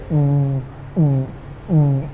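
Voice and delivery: closed-lip hum, held; soft teacher hum before a b; humming mm. Shown as a demonstration. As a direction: hum with lips pressed together. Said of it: "We say".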